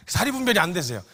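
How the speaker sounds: usual speaking voice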